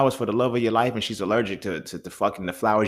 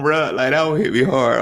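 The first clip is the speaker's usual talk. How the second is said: ordinary delivery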